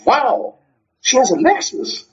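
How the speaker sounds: high-pitched, astonished wow; excited. Acted. Friend reacting to neighbor's new car, excited. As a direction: act out an amazed friend. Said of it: "how the mood changes".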